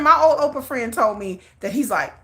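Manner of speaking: ordinary delivery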